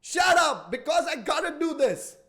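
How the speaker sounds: loud, high shout of "Shut up!"; shouting back. Acted. Telling the little voice in his head to shut up, shouting. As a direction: yell down the doubting voice. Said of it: "and say".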